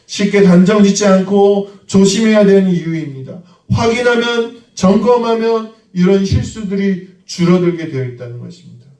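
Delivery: fervent sermon delivery; full-force preaching. Acted. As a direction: preach it out to the congregation.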